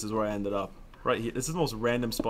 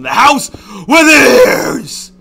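sarcastic rant shouted much louder and higher; angry yell; 13 semitones higher, 21 dB louder, much slower than usual. Real, not acted. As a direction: vent at the whole house at full volume. Annoyed about answering the doorbell.

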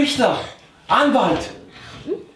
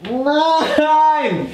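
higher, drawn-out no; dismayed cry; 7 semitones higher than usual. Real, not acted. Player crying out as the other team wins. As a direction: wail it as the round is lost.

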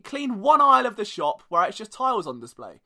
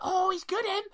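cleaner's clueless question; 10 semitones higher than usual. Retold or imitated. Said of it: "She's like".

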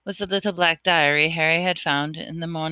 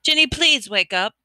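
desperate; 7 semitones higher, 3 dB louder than usual. Acted. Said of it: "Harry muttered desperately".

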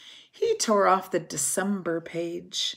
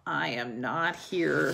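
lower, softer grumpy voice; sleepy Toad; 4 semitones lower, 3 dB quieter than usual. A narrator's reading. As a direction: mumble it from under the covers. Toad hiding in bed.

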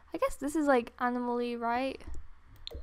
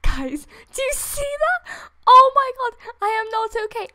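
much higher, much louder squealing cry; excited shriek; 9 semitones higher, 10 dB louder than usual. Real, not acted. Fan showing viewers the game, squealing loudly. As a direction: shriek with delight.

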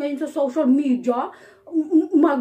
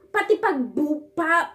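ordinary delivery

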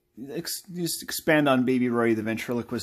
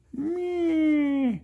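high puppet squeak. Acted. Dummy-like squeak.

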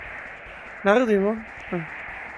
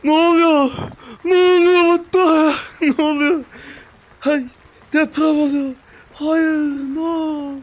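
panicked shout; 6 semitones higher, 4 dB louder than usual. Real, not acted. Building collapses, panicked shouting.

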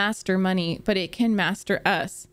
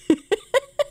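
high squealing laugh; 12 semitones higher, 3 dB louder than usual. Real, not acted. Reacts to absurd scammer question.

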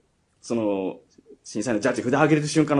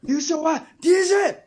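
MC announcement; slower than usual. Retold or imitated. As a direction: belt out the winner like a host. MC announcing the winner.

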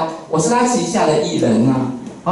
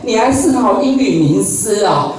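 put-on higher, teasing TV co-host laughing at his accent; 罗美美 mocking; 4 semitones higher than usual. TV co-host teases the English teacher, mock sneer pitched higher. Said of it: "她在节目里面最喜欢讲这种话".

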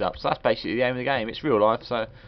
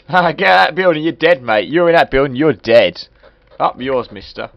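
taunting; 8 dB louder than usual. Real, not acted. Taunting game building.

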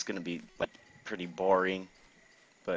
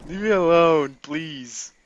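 loud, raised plea; exasperated, higher shout; loud protest; 6 semitones higher, 7 dB louder, slower than usual. Real, not acted. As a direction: call out to be left alone. Calling at game enemy.

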